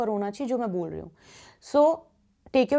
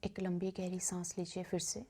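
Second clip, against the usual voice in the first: guided meditation; 2 semitones lower, 6 dB quieter than usual. Acted. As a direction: guide listeners gently into stillness, softening on repeated phrases. (धीमी, शांत ध्यान कराने वाली आवाज़)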